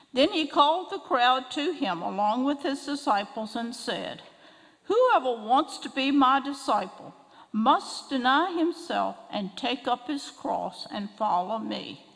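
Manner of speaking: ordinary talk, nothing marked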